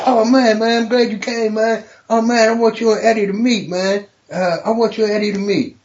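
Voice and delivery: high, eager, hurried greeting; Martin Lawrence. Retold or imitated.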